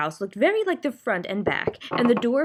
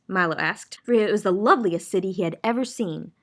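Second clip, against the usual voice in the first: plain reading voice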